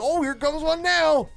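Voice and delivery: happy-go-lucky, high, drawn-out voice; Booger. Retold or imitated. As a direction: imitate a slacker in blissful ignorance. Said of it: "he's all happy-go-lucky".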